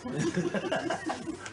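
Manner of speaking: high burst of laughter. Real, not acted. Speaker amused by own country-house joke, laughing. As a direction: laugh out at your own quip.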